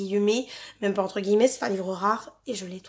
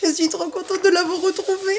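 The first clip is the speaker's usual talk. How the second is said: high giddy squeal of joy; gushing with giddy delight; overexcited squeal; 7 semitones higher than usual. Real, not acted. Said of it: "la meuf elle est surexcitée".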